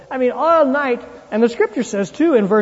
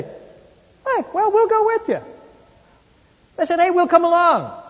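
eager followers; 9 semitones higher than usual. Retold or imitated. Disciples reply eagerly.

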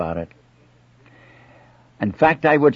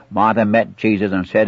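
ordinary talk, nothing marked

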